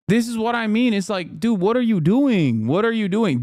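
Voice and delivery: rising, pressing "what are you doing"; exasperated disbelief. Real, not acted. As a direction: push the disbelief up and out. Reacting incredulous to a post.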